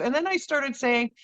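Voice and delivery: usual speaking voice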